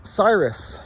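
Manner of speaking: usual speaking voice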